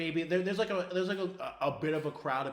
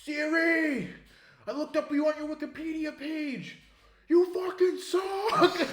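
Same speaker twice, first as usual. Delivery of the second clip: put-on loud, high drunken Boston heckle; drunk New England accent; 12 semitones higher, 7 dB louder, slower than usual. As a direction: yell it like a hammered Boston fan. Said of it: "New England accent".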